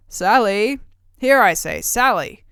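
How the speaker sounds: loud call to the next room; ogre calling his wife. Acted. Ogre calls his wife from the kitchen, loud call. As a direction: bellow her name across the house. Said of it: "And he called Missus Miyaka".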